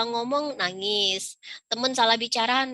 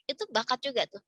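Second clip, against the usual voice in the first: ordinary talk, nothing marked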